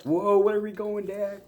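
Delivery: high squeaky dog voice; voicing the dog. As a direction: give the dog a surprised little high voice. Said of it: "he's like".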